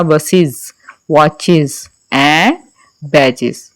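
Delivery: "बसेस, वॉचेस and बैजेस" each ending in iz; iz plural ending. Shown as a demonstration. Teacher models es plurals, clear iz ending. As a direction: say "बसेस" as bus-iz with a voiced z. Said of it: "ज sound pronounce करनी है".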